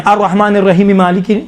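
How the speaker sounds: الرحمن الرحیم مالکی joined in one breath; no pause after الرحیم; run on without stopping. Shown as a demonstration. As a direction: link الرحیم straight into مالکی as "rahimi-maliki". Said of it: "نہیں".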